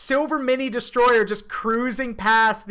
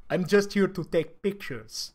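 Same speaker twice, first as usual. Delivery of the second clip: put-on soft, lower Russian-accented echo; mimicked Russian accent; 4 semitones lower, 5 dB quieter than usual. Listener repeating the Russian commander's quote, soft Russian accent. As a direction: quietly parrot the Russian's broken English. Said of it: "radios back in broken English".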